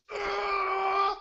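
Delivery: long, high, loud scream; frustrated scream. Retold or imitated. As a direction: scream it out at full pitch. Acting out frustrated character.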